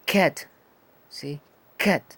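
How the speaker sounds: cat said loud and crisp; teacher modelling English; model word. Shown as a demonstration. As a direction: model cat clearly for the class. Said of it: "La que sigue".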